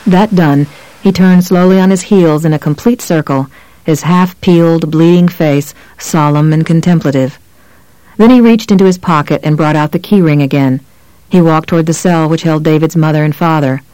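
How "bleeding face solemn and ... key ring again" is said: usual speaking voice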